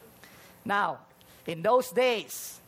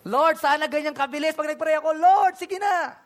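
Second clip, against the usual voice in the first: higher and louder, begging; pleading prayer; 7 semitones higher, 7 dB louder than usual. Pretend. A believer urging the Lord for a quick answer, high and loud. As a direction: beg the Lord eagerly.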